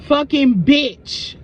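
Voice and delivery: slow, drawn-out, loud curse; spirit's voice. Retold or imitated. Spirit cursing the lucky coworker, slow and loud. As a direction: stretch every syllable with contempt. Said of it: "I heard Spirit say".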